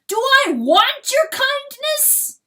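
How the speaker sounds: high screamed rhetorical question; furious cry. A narrator's reading. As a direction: scream it in outraged rejection. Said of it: "cries Chanticleer".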